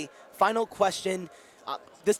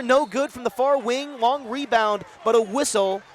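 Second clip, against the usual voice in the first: energetic basketball commentary, rising to excited calls; live play-by-play; 3 dB louder than usual. Real, not acted. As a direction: call the game live, surging on the big shot.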